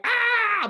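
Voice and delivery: high, loud drawn-out "ah" scream; retold inner scream. Son hearing dad's advice, screaming inside. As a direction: scream out the held-in frustration. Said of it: "And I'd be like".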